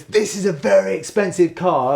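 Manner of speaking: mimicked neighbour. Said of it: "had given me all this".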